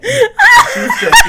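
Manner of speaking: laughing. Real, not acted. Retelling the mix-up, laughing.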